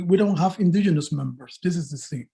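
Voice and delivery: normal talking voice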